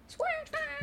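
high, excited show-name call; hype call. Acted. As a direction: shout it like a cheer.

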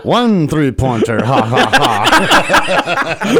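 slow, theatrical counting vampire with a big laugh; mock Sesame Street Count, very slow. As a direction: count it out slowly like the Muppet vampire, then cackle. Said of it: "I wanna see the Muppets compete".

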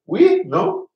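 very high, loud teasing question; mock girl's voice. Imitating the French girl teasing him, high and loud. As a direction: squeak it out like a playful girl.

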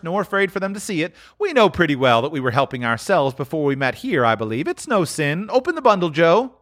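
high, fast and bold; brazen woman. Acted. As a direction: justify the theft, hard.

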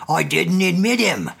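put-on sulky grumbling old man; grumbling old servant. A narrator's reading. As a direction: grumble the excuse. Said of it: "grumbled the old man".

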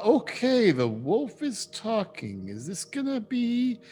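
high, shocked elf voice; an elf asking his own wolf whether it talks; startled elf. Acted. Shocked elves question their wolves.

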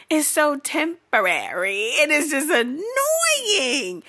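high, drawn-out comic wail; exasperated whine. Real, not acted. Ranting about new love, exasperated whine. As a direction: stretch the last word into a whine.

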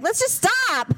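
high, fed-up plea; exasperated plea. Retold or imitated. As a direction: beg them to stop. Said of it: "I'm like".

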